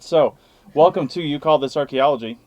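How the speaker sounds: ordinary talk, nothing marked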